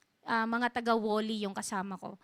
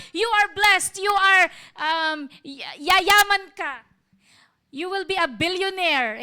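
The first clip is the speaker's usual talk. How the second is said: loud, raised, drawn-out proclamation; big, slow blessing from the pulpit; preacher's declaration; 5 semitones higher, 9 dB louder, slower than usual. Acted. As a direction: proclaim it boldly to a congregation. Acting out a pastor's prosperity declaration.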